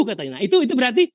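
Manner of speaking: ordinary talk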